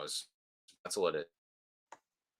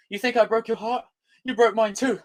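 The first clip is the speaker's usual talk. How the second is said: high, overwrought romantic delivery; milk the heartbreak; melodramatic lover; 11 semitones higher than usual. Pretend. Reading a cringy film line to chat, high and soppy.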